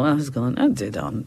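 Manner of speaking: low mumble with lips barely parted; softly mumbled, words run together; mumbling. Shown as a demonstration. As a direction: mutter it without opening your mouth. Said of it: "It's a mumble".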